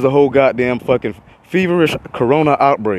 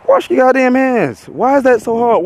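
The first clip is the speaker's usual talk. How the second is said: heated rant; 10 semitones higher than usual. Real, not acted. Ranting at people who skip handwashing.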